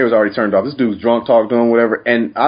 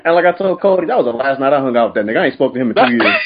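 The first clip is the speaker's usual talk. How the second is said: ordinary delivery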